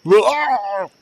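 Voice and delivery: a cartoonish yelp of a falling giant. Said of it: "Giant [name] came by and was like".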